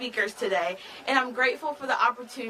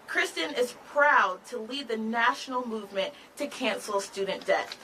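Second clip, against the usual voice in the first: ordinary talk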